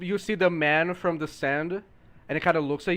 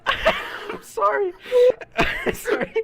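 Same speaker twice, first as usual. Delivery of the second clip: apologizing through high laughter; gasping laughter; laughing; 5 dB louder, slower than usual. Real, not acted. Can't stop laughing.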